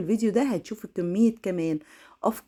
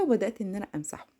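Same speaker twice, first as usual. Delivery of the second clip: ordinary talk, nothing marked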